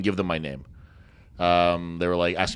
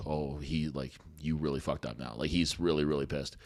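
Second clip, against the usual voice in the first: softer, low mom voice warning him; hushed warning; 7 semitones lower, 11 dB quieter, slower than usual. Retold or imitated. Mom quietly warning her son that dad is furious. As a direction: murmur it like a worried mom. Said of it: "my mom was like".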